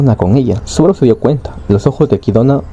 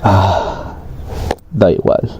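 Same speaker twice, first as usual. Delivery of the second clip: broken resignation; 4 semitones lower, 4 dB quieter, much slower than usual. A narrator's reading. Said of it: "Subaru se sintió roto".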